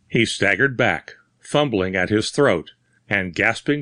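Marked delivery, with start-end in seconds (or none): none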